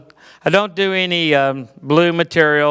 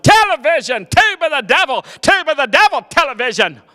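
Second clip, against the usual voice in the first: preaching against TV; 8 semitones higher than usual. Retold or imitated. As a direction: thunder at the tube. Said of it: "My dad preached against television".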